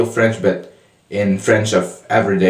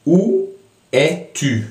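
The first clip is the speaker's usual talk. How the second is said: formal French; 6 semitones higher than usual. Shown as a demonstration. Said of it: "in formal French".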